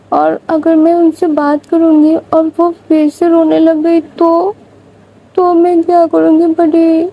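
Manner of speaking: put-on a small child begging her big sister what to do; pleading little girl.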